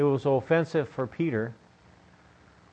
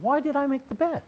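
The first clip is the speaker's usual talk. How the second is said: much higher, baffled exasperation; exasperated why; 11 semitones higher than usual. Retold or imitated. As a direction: throw up your hands at the pointless bed. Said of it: "I stand there just going".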